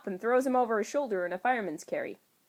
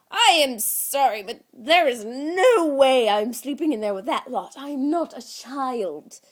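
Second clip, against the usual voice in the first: higher, louder, slowing on NOT; indignant refusal; 6 semitones higher, 9 dB louder than usual. Acted. Loki refusing the kids' room, high indignant insistence. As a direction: insist like an offended little god.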